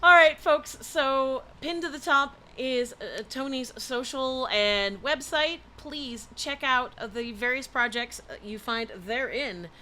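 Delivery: loud, high announcer sign-off; show outro. Acted. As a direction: wrap up the show for the folks. Outro to audience.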